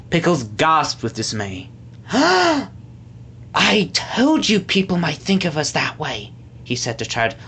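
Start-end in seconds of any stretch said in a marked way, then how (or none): 2.1-6.3 slow, wounded complaint; deeply hurt. A narrator's reading. Pickles to Chad, wounded and hurt. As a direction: let the hurt stretch out the words. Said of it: "looking deeply hurt".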